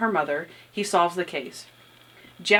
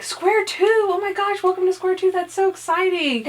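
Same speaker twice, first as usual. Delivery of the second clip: much higher, excited imitation of Natalie; Natalie gushing; 11 semitones higher than usual. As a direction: squeal it like a thrilled cheerleader. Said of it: "she's like".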